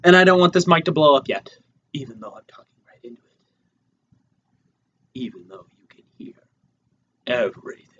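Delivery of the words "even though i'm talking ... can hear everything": quick, hushed whisper; hushed mic whisper. Pretend. Podcaster talking right into the mic, hushed whisper. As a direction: whisper right up close into the microphone.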